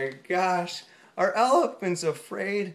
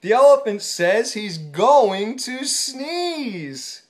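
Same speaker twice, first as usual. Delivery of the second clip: slow warning; 3 semitones higher, 6 dB louder, slower than usual. Acted. Repeated warning, slowed down.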